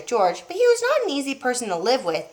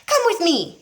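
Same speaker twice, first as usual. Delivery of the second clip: eager yell; 8 semitones higher than usual. A narrator's reading. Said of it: "Mister Cranky yelled".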